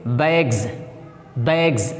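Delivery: बैग्स ending in a voiced z; teacher models the z in बैग्स; z sound in bags. Shown as a demonstration. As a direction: finish बैग्स with a z buzz. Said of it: "इस एस को हम जा की आवाज़ पढ़ेंगे".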